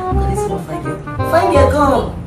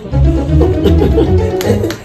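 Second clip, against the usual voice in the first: laugh; 8 semitones higher than usual. Acted. Nervous laugh at ma's stare.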